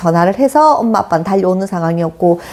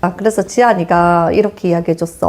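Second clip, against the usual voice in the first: ordinary talk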